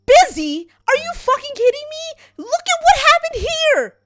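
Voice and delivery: furious high shout; exploding at him. Acted. Lesim explodes at Ithric for vanishing, furious high shout. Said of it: "Lesim explodes and goes".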